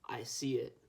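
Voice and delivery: plain reading voice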